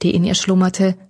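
ordinary delivery, nothing marked

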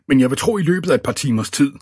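fast, high, loud gabble; babbling. A narrator's reading. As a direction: rattle on nervously to the boss. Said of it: "bablede Eddie løs". (hurtig, høj bablen)

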